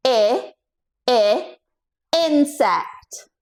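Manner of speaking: I, I, insect with the short i of insect; short i sound. Shown as a demonstration. Coach sounding out insect for kids. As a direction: repeat I, I before insect. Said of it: "give me an I".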